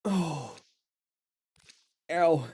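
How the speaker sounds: pained little groans; wincing from aching muscles; sore muscles. Real, not acted. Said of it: "I'm so sore today already".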